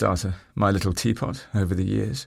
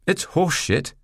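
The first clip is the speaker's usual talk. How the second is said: blunt female voice; 9 semitones higher than usual. A narrator's reading. Sophie retorts.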